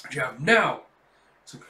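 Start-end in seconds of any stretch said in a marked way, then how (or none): 0.4-0.8 sharp loud "now"; shouted command. Acted. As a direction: fire the signal to attack. Commander's signal to attack.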